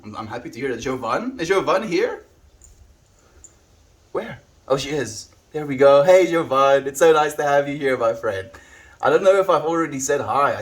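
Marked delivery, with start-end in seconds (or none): 1.4-5.3 surprised. Real, not acted. 5.5-10.5 excited greeting. Real, not acted.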